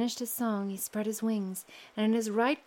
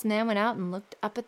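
plain reading voice

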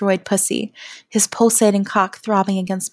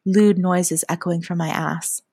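ordinary delivery

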